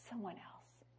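soft, barely voiced repeat; whispered echo. Real, not acted. Preacher repeats the phrase to the congregation in a whisper. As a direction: breathe it out in a whisper. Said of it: "Someone else understood".

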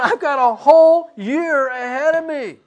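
high, loud, half-laughing exclamation; giddy mock despair. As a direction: declare it loudly, on the edge of laughter. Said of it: "And I just started laughing".

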